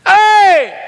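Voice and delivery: put-on high, drawn-out holler of a teenage girl; calling out. Girl calls out to another girl in McDonald's, high holler.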